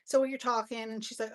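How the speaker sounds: ordinary talk, nothing marked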